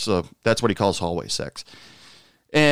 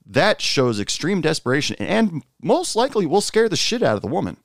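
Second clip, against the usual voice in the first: usual speaking voice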